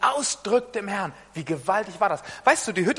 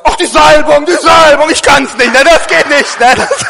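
much louder and higher, mock excuse; mock shout; 6 semitones higher, 14 dB louder than usual. Preacher mocking a lazy husband, mock shout. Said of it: "dass du sagst".

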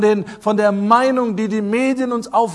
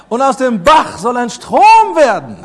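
loud, high, rousing proclamation; preacher building to a ringing charge; full-force preaching; 5 semitones higher, 7 dB louder than usual. Real, not acted. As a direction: call it out to the whole congregation.

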